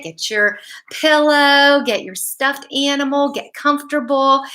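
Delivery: plain reading voice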